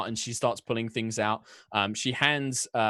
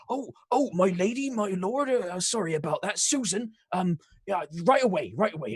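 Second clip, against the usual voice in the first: put-on higher-pitched nervous English accent; English accent, flustered husband; 9 semitones higher, 5 dB louder than usual. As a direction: bumble apologies to the nobles in English accent. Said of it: "Too many English accents".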